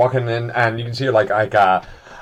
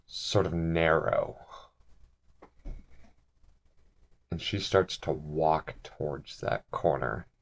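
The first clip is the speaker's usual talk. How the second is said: soft, slow, low tense narration; suspense narration; 8 semitones lower, 11 dB quieter, slower than usual. A narrator's reading. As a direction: draw the players in slowly and quietly.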